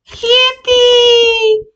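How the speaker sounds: very high, loud squeal; piercingly high mock cheer; mock celebration. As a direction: squeal it out in exaggerated glee.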